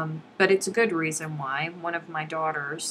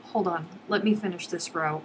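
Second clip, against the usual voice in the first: ordinary talk, nothing marked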